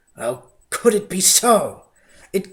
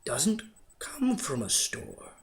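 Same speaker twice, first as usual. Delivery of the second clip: slow, soft whisper; whispered thought; 8 dB quieter, slower than usual. A narrator's reading. The Grinch whispers his realization to himself. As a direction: breathe the thought. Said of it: "he thought".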